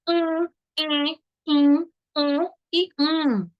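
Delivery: each of UM, EM drawn out as a nasal hum; nasal vowel sounds. Shown as a demonstration. Teacher listing vocalic digraphs, nasal sounds held. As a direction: hold each nasal vowel separately, with pauses. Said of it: "quando a vogal está acompanhada de M ou N".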